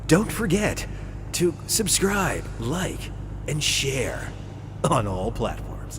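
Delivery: measured radio sign-off. Shown as a demonstration. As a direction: pitch it like a radio promo, spacing each phrase. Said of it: "using my radio voice".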